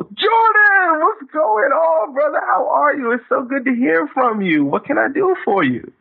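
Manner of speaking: light up at the caller's name; thrilled to hear from him. Shown as a demonstration. Greeting a caller by name with sudden high excitement. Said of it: "lit up my day".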